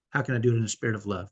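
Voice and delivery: usual speaking voice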